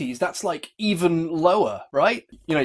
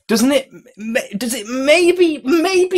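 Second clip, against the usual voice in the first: rising insistence; 10 semitones higher, 6 dB louder, slower than usual. Real, not acted. Critic pressing a leading question.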